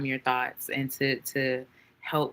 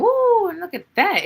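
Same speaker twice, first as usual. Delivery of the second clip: much higher and louder burst of delight; excited woo; 7 dB louder than usual. Real, not acted. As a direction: whoop with joy at the card. Excited by new card.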